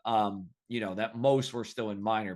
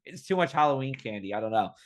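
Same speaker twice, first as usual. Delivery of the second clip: usual speaking voice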